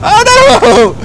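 high, loud panicked yelp; high-pitched cry of dismay. Real, not acted. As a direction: yell it in alarm, very high. Panics as game goes wrong.

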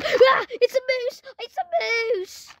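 high play-acted shriek of terror; panicked scream. Sheriff spots the moose he fears, mock-panicked scream. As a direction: scream high, then stretch it out, fading.

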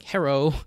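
Hero said as "herro" for hello; mock Japanese-accented pronunciation. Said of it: "racist depictions of, like".

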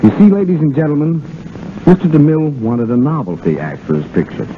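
ordinary delivery

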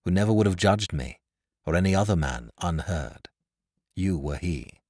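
lightly voiced Edward. A narrator's reading. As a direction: answer the accusation in a low, measured tone.

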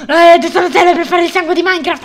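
loud burst of explanation; abrupt full-volume announcement; sudden loud declaration. Pretend. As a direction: blare it out after whispering. Sudden loud switch after whispers.

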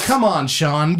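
normal talking voice

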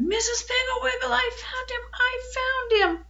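storyteller's held-back, high holler; acted shout. A narrator's reading. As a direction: call out the news across a field. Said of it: "stood up and shouted".

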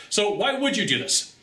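ordinary talk

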